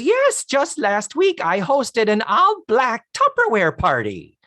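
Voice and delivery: gushing sweet lady; high gushing lady voice. Retold or imitated.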